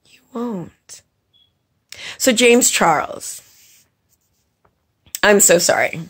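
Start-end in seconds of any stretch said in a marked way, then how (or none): 0.0-1.0 breathy whispered jab; a barely voiced put-down. Real, not acted. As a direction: drop to a whisper for the dig. Cutting aside after pause.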